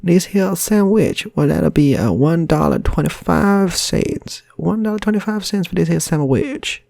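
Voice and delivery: high, slow, loud Southern drawl; cowboy shopkeeper, way up high; American shopkeeper accent. Retold or imitated. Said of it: "I can only do two American accents".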